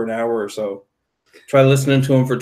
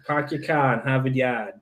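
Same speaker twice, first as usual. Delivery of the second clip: thick Boston accent, no R's; car in Harvard Yard without R's, Boston accent; 6 dB quieter than usual. Shown as a demonstration. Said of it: "about as thick as I can get my Boston accent".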